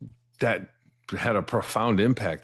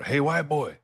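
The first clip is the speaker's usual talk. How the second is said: higher voice calling down the hallway to a neighbour; 7 semitones higher than usual. Retold or imitated. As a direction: call out across the cells, friendly and coaxing. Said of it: "I hear him call over".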